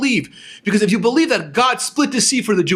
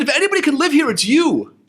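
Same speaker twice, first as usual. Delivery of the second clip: high, insistent retold comeback; incredulous retort; 7 semitones higher than usual. Speaker telling rich guy he could live here, incredulous. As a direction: push back, pitched up in disbelief. Retelling his comeback to jealous friend.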